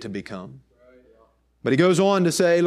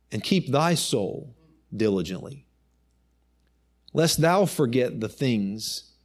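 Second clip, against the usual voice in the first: solemn scripture reading; 3 semitones lower, slower than usual. Real, not acted. Preacher reading the warning to take heed, solemn scripture reading. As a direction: read it gravely, phrase by phrase, with long pauses. Reads scripture aloud slowly.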